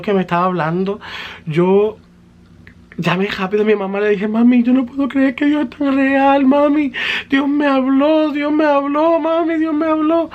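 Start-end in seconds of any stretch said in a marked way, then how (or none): 4.3-10.3 much higher and louder, sobbing with joy; breaking voice, high and loud in disbelief; overwhelmed crying. Retold or imitated. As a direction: cry it out to mom, stunned and overjoyed. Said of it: "Empecé a llorar y a llorar".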